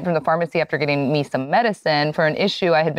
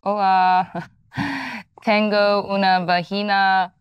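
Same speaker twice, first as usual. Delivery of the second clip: playful Spanish with a laugh; Spanish phrase; 4 semitones higher, slower than usual. Pretend. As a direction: pretend to order at the pharmacy in Spanish. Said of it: "I would say something like".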